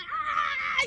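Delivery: high-pitched imitated wail; hammed-up shriek, kept soft; crazed mock scream. Imitating the friend going crazy, crazed mock scream. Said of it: "she started going crazy".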